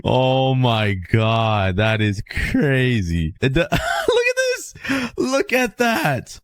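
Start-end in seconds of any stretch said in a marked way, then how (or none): 3.7-6.4 high, slow, laughing look at this; giddy with disbelief; laughing amazement. Real, not acted. Melon world spreads.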